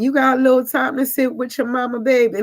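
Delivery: put-on Southern accent, sweetly calling her baby. Older coworker inviting her to sit, Southern accent. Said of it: "you hear that Southern accent come in".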